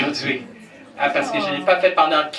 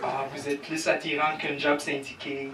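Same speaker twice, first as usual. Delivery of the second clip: mock smooth pickup line; 9 dB quieter than usual. Pickup line to audience woman.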